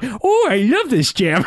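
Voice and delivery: put-on high, slow old granny voice; delighted old grandma, drawn out slowly. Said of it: "going to do a grandma voice".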